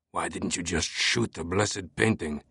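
put-on restrained fuming in a French accent. A narrator's reading. Police captain rebuking the warden who was disarmed, fuming. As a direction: seethe quietly with a French accent. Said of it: "Bezu Fache was fuming".

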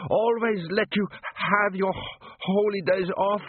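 high, stammering plea; shaking with fear. A narrator's reading. Butcher begging the golem with the cleaver, shaking with fear. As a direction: plead slowly, tripping on the words. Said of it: "Sock began to shake".

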